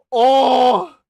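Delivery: loud, high drawn-out "oh"; big hammed-up outburst; exclaimed reaction. Mock outcry at money offer, retold.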